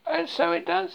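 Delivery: very high little echo of agreement; Piglet. A narrator's reading. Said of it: "said Piglet".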